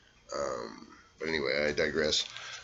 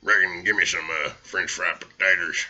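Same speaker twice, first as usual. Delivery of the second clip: Sling Blade impression; 6 dB louder than usual. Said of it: "Sling Blade".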